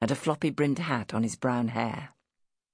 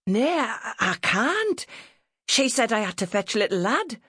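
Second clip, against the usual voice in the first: high-pitched protesting lad, northern dialect; flustered country boy; 6 semitones higher, 4 dB louder than usual. Acted. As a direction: object in a high, rising voice.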